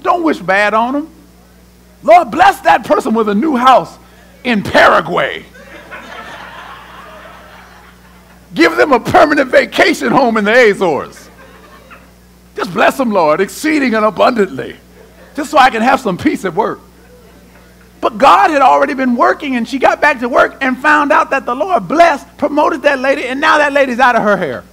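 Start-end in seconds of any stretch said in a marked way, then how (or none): 2.0-16.8 hammed-up pious prayer, louder; loud mock blessing; mock sweet prayer. Mock prayer against an enemy. 18.0-24.6 higher and louder preaching drive; rising preacher voice; preaching at full force. Real, not acted. Preaching climax.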